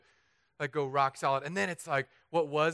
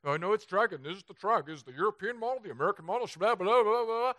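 higher know-it-all forecaster voice, rattling off models; mock forecaster; 5 semitones higher than usual. Mocking hurricane forecasters for the congregation, higher know-it-all voice. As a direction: lampoon the weather experts, higher. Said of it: "and they're like".

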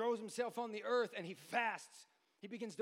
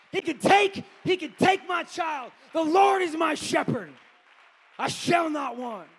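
loud, high, shouting sermon climax; full-force preaching; 8 semitones higher, 17 dB louder than usual. Acted. Preacher warning the congregation about woundedness, shouting and half-singing. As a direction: preach it at the top of your voice, almost singing.

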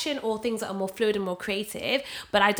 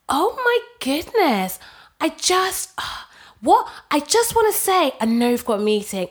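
gushing colleague; 3 semitones higher, 4 dB louder than usual. Retold or imitated. Mock colleague interrupting meeting.

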